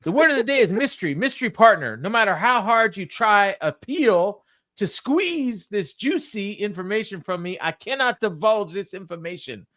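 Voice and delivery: hammed-up promo, pitched well up; Mark Briscoe. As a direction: act out a wrestler's wordy backstage promo. Retelling Briscoe's interview promo.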